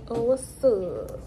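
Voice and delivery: ordinary talk, nothing marked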